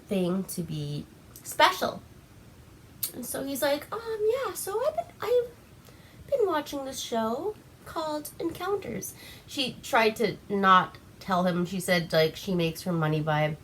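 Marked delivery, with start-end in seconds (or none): 3.9-9.1 high, whimpering put-on voice of the killer; mock whiny killer. Friend retelling the killer's confession, mock whimpering. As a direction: whine it out like a sad creepy man.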